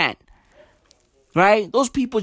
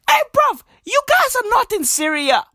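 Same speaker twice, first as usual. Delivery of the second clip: outraged. Real, not acted. Vlogger shouting at the task force, outraged. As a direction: yell it in disbelief.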